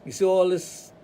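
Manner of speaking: usual speaking voice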